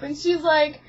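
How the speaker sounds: usual speaking voice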